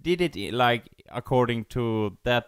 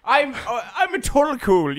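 pitched up, smug and loud; high mock king voice; 11 semitones higher, 6 dB louder than usual. Voicing the king.

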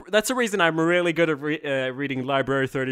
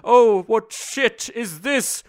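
hammed-up, louder, higher doom poem; mock dramatic poem recital; 3 semitones higher, 3 dB louder than usual. Improvising a poem with a friend, hammed-up recital. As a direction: declaim it like a silly tragic bard. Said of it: "do a poem with me".

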